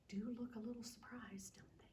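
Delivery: soft, quick whispered aside. Real, not acted.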